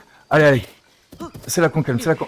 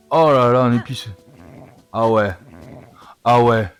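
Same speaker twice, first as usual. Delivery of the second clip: usual speaking voice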